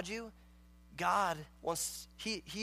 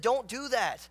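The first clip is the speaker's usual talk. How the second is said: urgent warning; 5 semitones higher, 6 dB louder, slower than usual. Acted. Acted elders warning the king.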